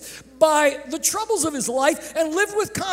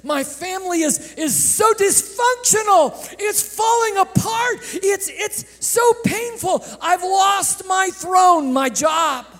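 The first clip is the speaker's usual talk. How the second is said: rising, anguished preaching shout, higher and louder; David's anguished cry; 6 semitones higher, 5 dB louder than usual. Acted. Preacher voicing David's lament to God, shouting. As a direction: cry it out like a king who has lost everything. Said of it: "he's thinking that in those verses".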